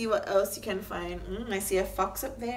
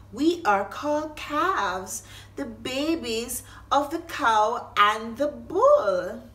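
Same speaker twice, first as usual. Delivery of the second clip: put-on higher, louder baby calf voice, slowed down; 3 semitones higher, 7 dB louder, slower than usual. Reading a farm book to children, voicing the little calves. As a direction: play the little calves, sweet and slow.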